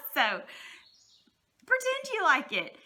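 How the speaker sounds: slow words squeezed through laughter; laughing. Real, not acted. Teacher giggling to parents, laughing and slow. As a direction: keep laughing as you say it. Laughing after her joke.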